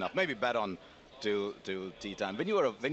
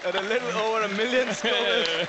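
laughing while reading; 6 semitones higher, 4 dB louder than usual. Real, not acted. Reads chili facts while laughing.

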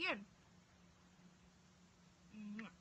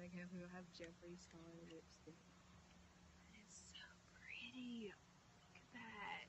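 awed whisper; 3 semitones lower, 12 dB quieter than usual. Real, not acted. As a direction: breathe it out in awe. Admiring the lipstick, hushed.

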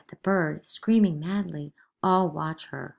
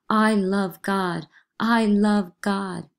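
slow desperate plea; 3 semitones higher, 7 dB louder, much slower than usual. Acted.

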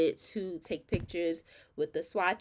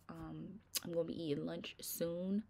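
normal talking voice

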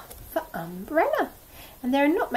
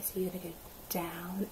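whisper; 4 semitones lower, 13 dB quieter than usual. Acted. Teacher guiding hand-writing.

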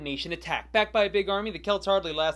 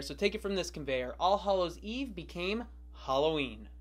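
ordinary delivery

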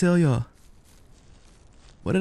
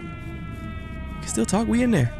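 squeaky high voice; 8 semitones higher, 4 dB quieter, slower than usual. Pretend. Repeating line in a new voice.

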